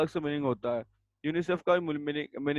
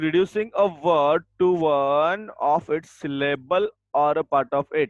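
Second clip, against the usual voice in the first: dictation; 5 dB louder, much slower than usual. Shown as a demonstration. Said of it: "dictating definition".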